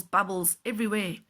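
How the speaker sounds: ordinary delivery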